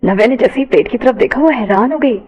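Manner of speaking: plain reading voice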